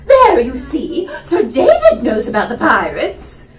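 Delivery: high, loud, slowed "There" with a rising laugh; triumphant fussing mother. Acted. Mother crows to Sir David about pirates, high and loud. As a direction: crow at being proved right.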